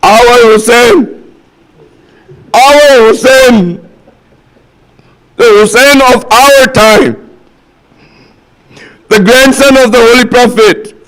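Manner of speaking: high, drawn-out preacher's cry; slow, ringing sermon lament; impassioned preaching. Acted. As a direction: call out each phrase slowly and fervently, pitched high.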